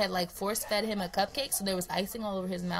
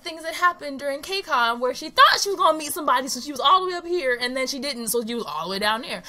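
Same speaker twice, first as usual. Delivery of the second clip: excited; 6 semitones higher, 9 dB louder than usual. Real, not acted. Sisters argue, defending herself.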